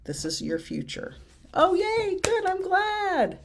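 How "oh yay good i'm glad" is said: delighted squeal. Real, not acted. Reacting happily to a chat message.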